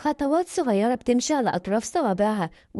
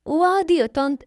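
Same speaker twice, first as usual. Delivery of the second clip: put-on high, eager little-girl voice; child promising; 7 semitones higher, 4 dB louder than usual. Little girl promises her stepmother, high and eager. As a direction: promise eagerly, pitched well up.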